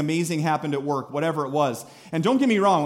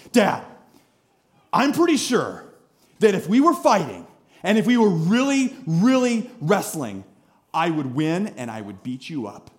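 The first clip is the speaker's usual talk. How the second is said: imitating a five-year-old's solemn challenge, word by word; earnest kid sizing up Dad, with weighty pauses; very serious little boy. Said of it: "he gets this really serious look".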